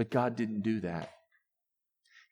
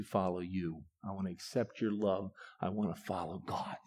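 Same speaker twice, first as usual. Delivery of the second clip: repentance prayer; 4 semitones lower, 4 dB quieter than usual. Acted.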